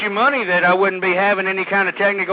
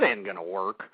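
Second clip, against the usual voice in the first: "it ain't gonna work" dropped much lower and softer; muttered; 12 semitones lower, 10 dB quieter than usual. Real, not acted. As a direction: let "it ain't gonna work" sink under your breath. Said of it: "It isn't gonna work".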